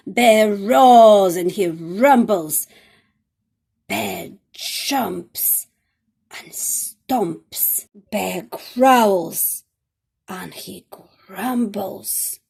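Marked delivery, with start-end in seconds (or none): 0.1-2.7 big booming read; loud bear roar. A narrator's reading. Angry bear roars. 8.1-9.6 loud, growled; loud growling read. A narrator's reading. Angry bear growls.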